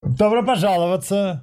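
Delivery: parody news anchor. Pretend. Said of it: "как ведущий новостей".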